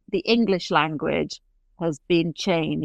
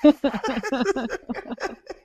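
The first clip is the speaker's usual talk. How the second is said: laugh; 7 semitones higher than usual. Real, not acted. Laughing at her own accent.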